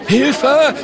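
high, loud shriek; panicked cry for help. Acted. A spectator fleeing the monster, high panicked yell. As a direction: scream it and flee. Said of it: "ruft ein Mann".

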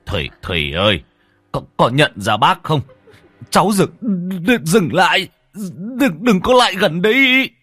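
frightened stammering. Acted. Brave man faces the crawling ghost, stammering in rising fear. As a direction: stammer climbing to a high, loud plea. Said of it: "bây giờ cũng lắp bắp".

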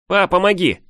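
high, loud call for help; boy's shout. A narrator's reading. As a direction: yell back to dad, pitch way up. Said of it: "крикнул, отвернув голову назад". (мальчишеский крик о помощи)

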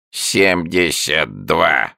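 slow, creaky animal voice; creaking newt. A narrator's reading. Newt greeting the visitors, slow creaky voice. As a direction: grind the words out like a creature.

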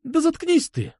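very high-pitched outburst; exasperated cry. A narrator's reading. Girl cutting off his love speech, exasperated cry. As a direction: cry out high, at the end of patience. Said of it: "крикнула она".

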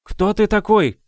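high, loud commanding demand. A narrator's reading. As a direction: demand it like the lady of the house. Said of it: "повелительным тоном". (повелительным тоном)

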